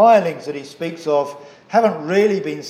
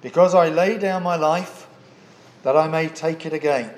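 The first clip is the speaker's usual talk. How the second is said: measured, solemn reading of scripture. Acted. Preacher reading the gospel passage to the congregation, solemn and measured. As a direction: read the verses aloud to the congregation. Reads scripture before sermon.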